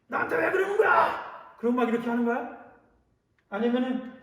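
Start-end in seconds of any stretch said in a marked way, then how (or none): 0.1-1.5 high, sharp confrontational yell; acted angry shout. Pastor acts out arguing back at someone who despises you, angry shouting. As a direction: shout it like picking a fight. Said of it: "말다툼하고 막 윽박지르나요".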